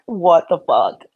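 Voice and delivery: usual speaking voice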